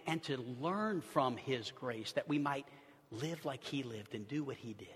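soft, low, intimate preaching; hushed reverent sermon. Acted. Pastor softly reflecting on grace to his listeners. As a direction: draw them close, almost confiding.

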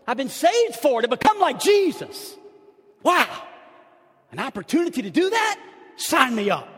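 high, loud fervent preaching; full-force preaching. Acted. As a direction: lift them up, building fire.

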